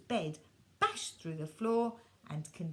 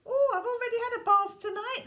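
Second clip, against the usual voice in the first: put-on high, loud, fast little-boy quip; soaked boy joking; cheeky boy; 13 semitones higher, 9 dB louder, faster than usual. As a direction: splash out the joke quickly. Walter lands in aquarium.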